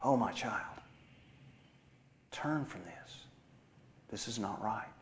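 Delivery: very soft, gentle correction; still small voice. Acted. Holy Spirit correcting a child of God, still small voice. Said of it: "He will say to you in a still small voice".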